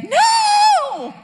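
high, loud cry of No!; mock-horrified yell; loud shout. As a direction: throw out a shocked shout. Reacting to wrong news.

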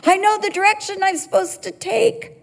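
high, headlong mock-confident declaration; hammed-up cry of total certainty; charging out. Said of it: "We go charging out".

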